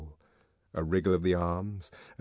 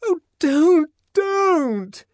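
high, loud wail, wobbling from being shaken; tremulous protest; 10 dB louder, much slower than usual. A narrator's reading. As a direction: protest while someone shakes you. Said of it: "protested in a voice made grotesquely tremulous by his shaking".

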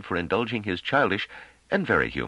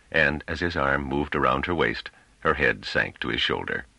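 ordinary delivery

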